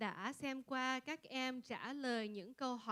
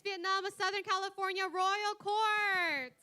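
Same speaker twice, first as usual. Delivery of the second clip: announcer voice; 7 semitones higher, 5 dB louder than usual. Real, not acted. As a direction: boom it out like a pageant emcee. Pageant host thanking royal court.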